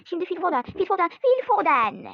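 excited commentary. Real, not acted. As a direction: call the player as he scores. Commentary on Foden's scoring run.

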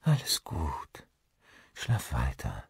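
soft, slow whispered comfort; tender whisper. A narrator's reading. Caro soothes Olli after his nightmare, whispering tenderly. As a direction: soothe him back to sleep. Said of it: "sie streichelte zärtlich sein Gesicht".

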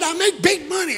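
shouted boast about money; loud brag. Retold or imitated. Cousin brags about money.

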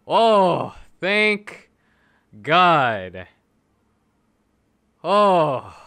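high, loud, drawn-out outburst of relief; shouted relief. Real, not acted. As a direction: cry out as the luck finally turns. Wanted hero finally appears.

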